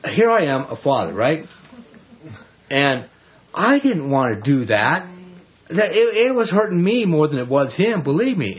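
ordinary delivery

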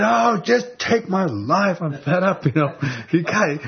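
high, slow exasperated plea; frustrated Jonah. Acted. Jonah, sun-beaten and fed up, begging God. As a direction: throw up your hands in despair. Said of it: "feeling so frustrated".

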